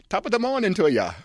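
high, loud mock Irish greeting; Irish accent. [name] as a beaver greeting, mock Irish accent. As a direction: chirp it like a cartoon Irishman.

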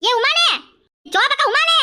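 high, carrying call for help. Acted. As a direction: summon your friends by name. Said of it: "கத்தி கத்தி கூப்பிட்டுக்கிட்டு கிடந்த". (தோழிகளை உரக்கக் கூவி அழைத்தல்)

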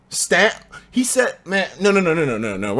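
usual speaking voice

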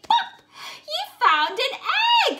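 high, loud delighted cry; excited pop. Acted. Teacher revealing an egg to the children, high and loud. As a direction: burst out with the find. Lifting the flap.

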